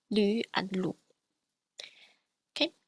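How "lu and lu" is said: lu with a plain u, then lu with an umlaut u; lu contrast. Shown as a demonstration. Teacher contrasts normal and umlaut lu. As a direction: contrast the two lu vowels. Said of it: "differentiate between sounds like".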